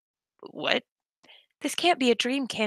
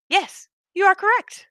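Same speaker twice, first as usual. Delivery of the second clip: much higher, composed character voice; prim doll voice; 9 semitones higher than usual. Acted. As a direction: answer like a proud doll.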